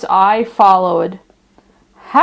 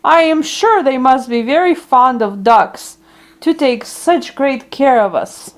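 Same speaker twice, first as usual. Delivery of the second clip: plain reading voice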